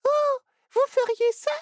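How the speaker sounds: put-on very high, eager little-girl voice; a tiny girl thrilled by the offer; delighted little girl. Little girl thanks the spider for the ride, delighted and very high. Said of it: "se réjouit Ciboulette".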